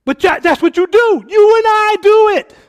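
loud, high preaching cry; preaching at full force. Real, not acted. A pastor confronting his congregation with loud, high preaching. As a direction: drive the point home, loud and high.

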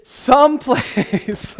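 a very slow "Someplace" that breaks into laughter; laughing. Real, not acted. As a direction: stretch the word and laugh through it. Laughing during a drawn-out word.

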